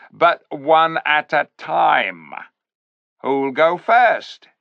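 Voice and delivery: high, slow, loud sly ape. A narrator's reading. Ape daring the beasts to go in, slow and sly. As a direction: dare them slowly like a smug ape.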